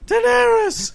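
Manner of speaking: very high, feminine shriek; high-pitched Night King. Pretend. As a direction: squeal it like a girly villain. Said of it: "it's gonna be super high-pitched and very feminine".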